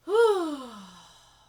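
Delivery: heavy sigh. Real, not acted. Heavy sigh while reading comments.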